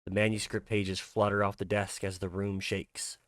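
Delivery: normal talking voice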